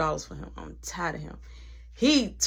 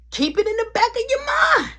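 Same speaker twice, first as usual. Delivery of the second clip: much higher, louder called-out warning; big booming reminder; dramatic warning; 7 dB louder than usual. Acted. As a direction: announce it like a foreshadowing.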